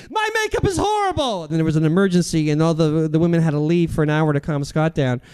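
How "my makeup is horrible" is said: retold scream. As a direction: imitate a shriek in a very high strained voice. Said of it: "and then Scott screamed".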